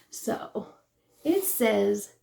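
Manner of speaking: ordinary talk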